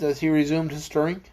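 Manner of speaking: plain reading voice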